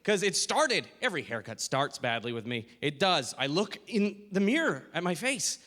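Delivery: normal talking voice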